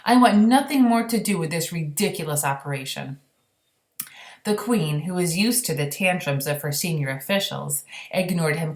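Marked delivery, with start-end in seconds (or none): 0.0-3.2 higher, louder outraged rant; furious general. Acted. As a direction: rant in a fury. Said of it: "he cried".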